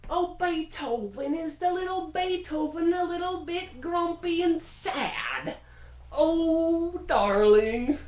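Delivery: put-on very high, slow, cooing baby talk; teasing a grump like a toddler; high syrupy mock-sympathy; Mozart mockery. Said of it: "And then Mozart's like".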